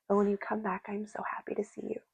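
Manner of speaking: soft tender whisper; hushed and loving. Real, not acted. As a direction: breathe it out gently. Tender message in a reading.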